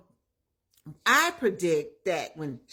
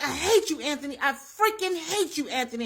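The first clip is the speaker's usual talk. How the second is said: mock outburst, higher on the hate; retold losing it; 6 semitones higher than usual. Woman to Anthony as she leaves, retold angry outburst. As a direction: imitate an upset woman storming out. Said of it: "Amber loses it".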